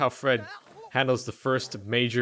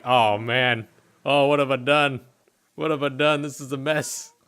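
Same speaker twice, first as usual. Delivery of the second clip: dismayed Fred; 5 dB louder than usual. Acted. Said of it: "Fred is like".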